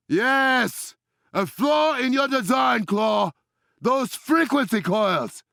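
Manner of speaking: high, shouted combat calls; urgent fight shouting; battle callouts. Acted. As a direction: call targets mid-fight.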